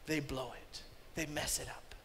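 low, soft near-whisper; hushed. Real, not acted. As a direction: drop to a whisper.

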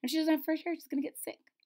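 ordinary delivery, nothing marked

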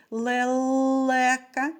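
"лелека" with the middle л held long; third sound stressed. Shown as a demonstration. Teacher asking children for the next sound, drawing out the second л of "лелека". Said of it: "Наступний звук у слові".

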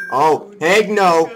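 loud, drawn-out refusal; emphatic refusal. Real, not acted. Player refusing to let Maria die, emphatic. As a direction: protest it slowly, high and loud.

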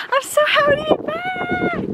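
high, squealing delight; gushing happy cheer. Acted. Friends on a hilltop hike, a high squealing cheer. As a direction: gush it out for the camera. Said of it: "We're just saying".

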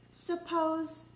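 ordinary delivery, nothing marked

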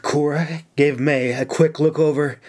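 ordinary delivery, nothing marked

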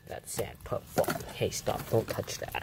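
whispered tiny ants; ants' voice. Pretend. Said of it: "The ants are like".

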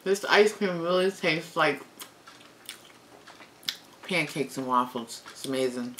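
words muffled by chewing; chewing while talking. Real, not acted. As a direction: mouth partly full of food. Seen 2: Eats waffle ice cream sandwich; chewing, lips pressed during words.

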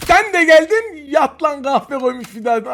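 slow, high, loud gloat; excited shout. Real, not acted. Gamer gloats at an enemy walking into his fire, excited. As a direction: crow as he arrives.

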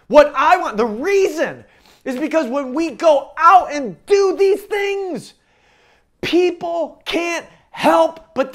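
loud, high-pitched preaching with drawn-out key words; full-force preaching. Real, not acted. Pastor driving home the mission of the church, full-force preaching. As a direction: preach it hard and loud to the whole room.

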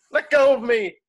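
high, loud protest; struggling shout. Acted. Protester shouting at security dragging her away, loud and high. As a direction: yell it while being dragged off.

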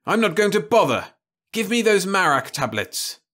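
angry demand. Acted. Jim angrily demanding tablets from Louise.

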